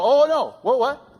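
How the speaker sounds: high, loud startled panic; panicked gasp. Acted. Preacher acting out a churchgoer's panic at confession, high alarmed cry. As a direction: yelp it like you've been caught. Congregants hearing the word confession.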